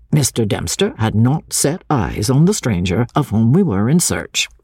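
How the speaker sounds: plain reading voice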